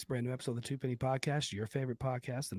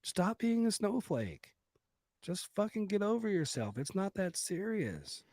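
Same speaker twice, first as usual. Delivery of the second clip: mock scolding; 8 semitones higher, slower than usual. Mock lecture to offended listeners.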